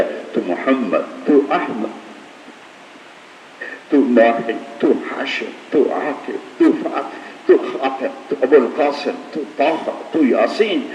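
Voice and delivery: a whispered list of loving names swelling into fervour. Acted. Litany of the Prophet's names.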